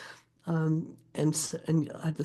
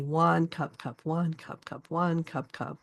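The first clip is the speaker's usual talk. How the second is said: "wand, cup, cup" in strong-weak-weak threes; dactylic meter; slower than usual. Shown as a demonstration. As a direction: stress each wand and let both cups drop away. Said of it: "So the dactyl would be".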